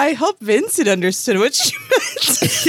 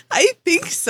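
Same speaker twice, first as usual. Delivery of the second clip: laughing; 8 semitones higher than usual. Real, not acted.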